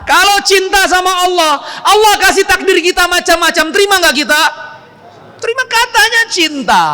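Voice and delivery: stirring the congregation; fervent preaching. Real, not acted. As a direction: lift it to a passionate peak. Preacher rises to a peak.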